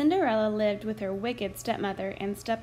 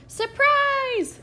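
high, loud squeaky cry; excited little friends; 7 dB louder than usual. A narrator's reading. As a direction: squeal it like tiny helpers. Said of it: "cried her little friends".

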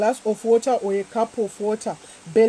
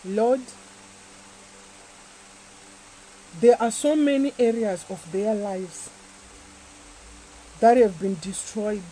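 prayer; 4 semitones lower, 6 dB quieter than usual. Acted. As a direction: pray gently over them. Quiet prayer over the water.

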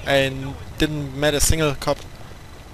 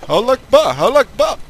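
shouted high battle cry; 9 semitones higher, 6 dB louder than usual. Pretend. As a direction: yell it like a charging fighter. Kamikaze attack battle cry.

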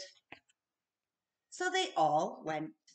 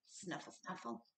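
much softer snuffling; hedgehog snuffle; 15 dB quieter than usual. A narrator's reading. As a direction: sniff around like a little hedgehog. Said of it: "went the hedgehog".

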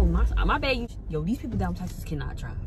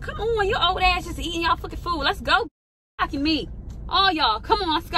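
loud, high, impatient yelling; 10 semitones higher, 5 dB louder than usual. Real, not acted. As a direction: hurry them up with a shout. Yelling at family to hurry.

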